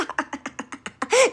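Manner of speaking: high gloating laugh; taunting laugh. Acted. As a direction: laugh in the critic's face.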